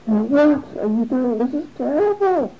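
high-pitched mock-appalled outcry; horrified protest. Poor man finds the offering eaten, mock-horrified. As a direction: act out exasperated horror.

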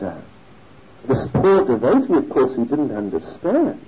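ordinary delivery

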